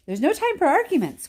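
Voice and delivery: eager cry. A narrator's reading. Wonka cutting off the arguing, eager. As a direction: hurry everyone along. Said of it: "cried Mister Wonka".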